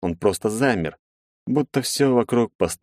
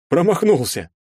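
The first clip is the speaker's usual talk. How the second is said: much higher, louder cry of disbelief; amazed cry; 10 semitones higher, 3 dB louder than usual. A narrator's reading. Friend cries out, stunned by the miss. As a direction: shout it out, stunned.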